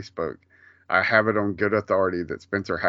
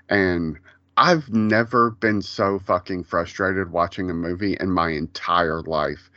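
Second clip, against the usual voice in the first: ordinary talk, nothing marked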